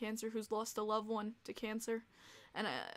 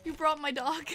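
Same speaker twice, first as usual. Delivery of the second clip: choked-up, louder happy cry; teary joy; 4 semitones higher, 6 dB louder than usual. Real, not acted. Streamer sees friend brought the dog, crying happily. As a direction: near tears at the surprise.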